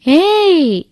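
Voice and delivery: high, loud delighted-surprise shout; surprised call. A narrator's reading. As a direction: call out in sudden surprise at seeing someone. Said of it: "నన్ను చూసిన ఆశ్చర్యంతో". (ఆశ్చర్యంతో గట్టిగా పిలుపు)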